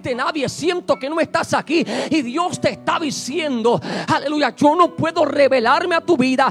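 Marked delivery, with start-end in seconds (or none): 4.1-6.5 rising sermon preaching. Acted. Preacher builds to loud exhortation.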